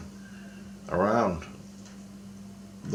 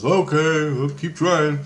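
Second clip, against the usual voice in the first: high, louder sing-out quote; imitated chirpy reply; 7 semitones higher, 4 dB louder, slower than usual. Questioner told there are no answers yet, imitated high reply. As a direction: lift the whole line up, mimicking him.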